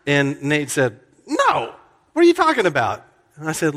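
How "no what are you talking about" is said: high, loud incredulous pitch jump; baffled disbelief. Retold or imitated. Friend on the phone, baffled by the rumor, high and loud. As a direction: sound floored by a silly question.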